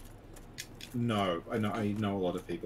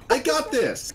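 high, loud anime battle cry; mock anime catchphrase, pitched way up; anime character; 8 dB louder, slower than usual. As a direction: shout it like a plucky little anime character. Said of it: "like a little anime character".

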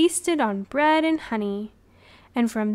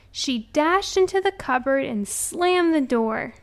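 ordinary delivery, nothing marked

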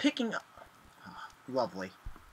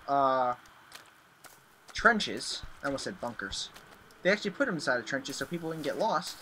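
ordinary talk, nothing marked

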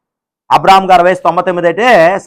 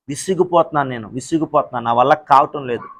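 much softer, lower, worn-out murmur; weary collapse; 4 semitones lower, 6 dB quieter than usual. Acted. Believer giving up before God, drained low murmur. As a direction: let the voice sink in exhaustion.

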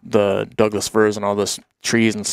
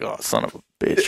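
muttered curse; 3 semitones lower, 6 dB quieter, faster than usual. Retold or imitated. Friend hurt, under-breath curse.

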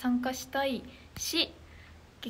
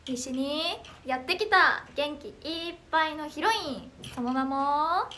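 louder, bright idol intro patter; idol self-introduction; 3 semitones higher, 4 dB louder, slower than usual. Pretend. Idol introducing herself to new viewers in a hammed-up heroine announcer voice. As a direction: announce yourself like a heroine taking the stage. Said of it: "ちょっと一回自己紹介したいと思います".

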